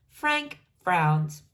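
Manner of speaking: plain reading voice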